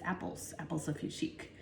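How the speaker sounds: breathless whisper under the breath; whispered aside. Real, not acted. Reading product name to herself, whispered. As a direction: whisper it to yourself.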